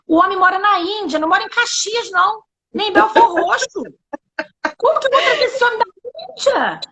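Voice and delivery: high-pitched comic disbelief, building to a rushed question; incredulous protest. Retold or imitated. Woman retells objecting to God's impossible request, high incredulous protest. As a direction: argue back in exaggerated disbelief, pitch up.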